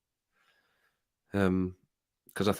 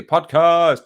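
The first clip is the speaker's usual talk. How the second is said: a loud echoed shout of the chat word; echoing a shout; 6 semitones higher, 9 dB louder than usual. Retold or imitated. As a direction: call it out loud, copying the chat. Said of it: "Dan's just shouting podcast".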